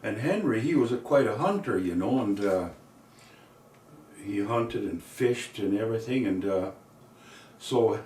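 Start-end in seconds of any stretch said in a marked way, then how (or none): none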